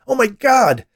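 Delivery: very high, loud cry; escalating panic, near scream; panicked exclamation. A narrator's reading. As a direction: shriek it in horror. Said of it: "he exclaimed".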